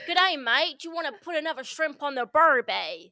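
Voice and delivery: hammed-up Australian accent. Shown as a demonstration. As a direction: put on a big, cheery Australian accent. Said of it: "give us your Australian".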